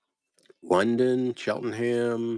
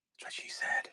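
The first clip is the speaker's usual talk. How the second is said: whispered joke; 10 dB quieter than usual. Real, not acted. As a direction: toss it off under your breath. Joking whispered aside.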